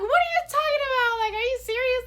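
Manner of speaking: very high, loud, incredulous squeal; imitated cracking up. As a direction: mimic someone dying laughing as they speak, shrieking. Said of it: "He was dying laughing".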